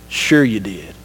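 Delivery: God gently calling out Sarah's fib; God's low retort. Acted. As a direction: answer her calmly, like God who already knows. God answers Sarah in retold story.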